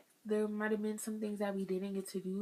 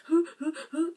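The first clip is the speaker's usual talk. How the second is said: gasping mock sobs, loud and high; boo-hoo sobbing demo; 10 semitones higher, 10 dB louder than usual. Shown as a demonstration. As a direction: act out a big boo-hoo cry, hoo hoo hoo. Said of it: "I'm talking about boo-hoo crying".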